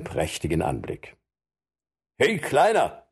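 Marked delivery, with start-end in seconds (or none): 2.2-3.1 high mocking call; a forester hailing Robin with a full mouth. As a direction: call out to the boy mid-bite. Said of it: "rief einer von ihnen mit vollem Mund".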